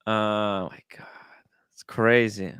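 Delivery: breathless whispered shock; unvoiced, hushed disbelief. Real, not acted.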